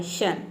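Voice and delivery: शन softly as "shun", a single short syllable; shun sound. Shown as a demonstration. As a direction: drop to a soft model शन. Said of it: "simply शन pronounce करना है". (शन को धीमे से "शन" उच्चारण)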